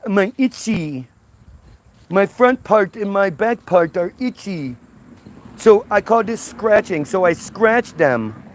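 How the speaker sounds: lightly voiced clueless Eve; ditzy Eve. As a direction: stumble over words like a simpleton. Eve explains itching.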